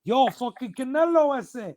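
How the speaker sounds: high, slow, loud mock hail; imitated Mexican guys. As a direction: call out like an excited Mexican fan. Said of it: "they're like".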